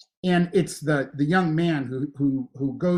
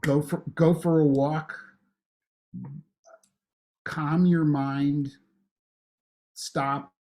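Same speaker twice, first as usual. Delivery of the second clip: guided meditation; slower than usual. Acted. Gives a calm slow prompt.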